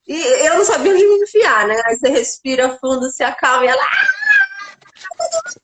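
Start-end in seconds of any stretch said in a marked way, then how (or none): 3.8-5.6 put-on child's cry. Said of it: "ela deu um grito".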